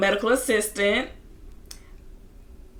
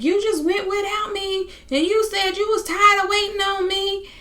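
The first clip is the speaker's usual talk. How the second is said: high-pitched wailing imitation; upset accusation; 12 semitones higher than usual. Upset woman accusing her girlfriend of leaving her, wailing. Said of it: "She's just yelling".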